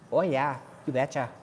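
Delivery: Minnesotan with long O's; Minnesotan accent. Shown as a demonstration. Streamer showing how Minnesotans sound, Minnesotan accent. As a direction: do a folksy Minnesotan, rounding the O's. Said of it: "the Minnesotan accent".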